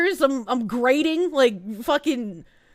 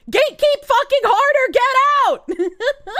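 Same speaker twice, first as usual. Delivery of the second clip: high, loud mock yell at the trolls; hammed-up outraged yell; 11 semitones higher, 6 dB louder, slower than usual. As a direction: shout them out of the room, half joking. Mock outburst at Twitter freaks.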